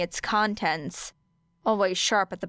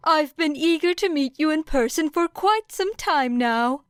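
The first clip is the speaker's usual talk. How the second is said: high, louder cheerful principal voice; eager, bright high voice; 8 semitones higher, 4 dB louder than usual. A narrator's reading. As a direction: sound delighted, pitched up high. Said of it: "he said brightly".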